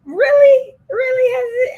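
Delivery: high, loud protesting questions; indignant, high-pitched surprise; loud incredulous disbelief. Real, not acted. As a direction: squeal it in disbelief at your friend. Teased challenge to friend's complaint.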